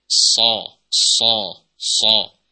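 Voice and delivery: so said thick and loud from the back of the mouth; heavy shad. Shown as a demonstration. Teacher drilling the heavy shad. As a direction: hit the heavy shad firmly. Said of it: "huruf shadnya kok bisa tebal".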